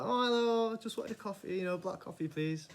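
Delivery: high, sweet put-on politeness; mock innocent. Greeting the girl he likes, high and sweet. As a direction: play it coy and charming. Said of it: "I'm like".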